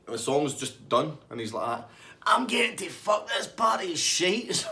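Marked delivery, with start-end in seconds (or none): 2.3-4.7 high, slow, loud yell; slow disgusted shout. Retold or imitated. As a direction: drawl it out in disgust as you leave. Gary storming off.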